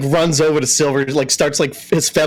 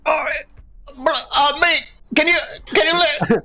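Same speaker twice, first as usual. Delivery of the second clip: high, strained voice of an owl being strangled; choked owl voice; 13 semitones higher than usual. Acted. Owl begs the grappling fighter to let go, high and strangled. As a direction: plead while being throttled by the neck.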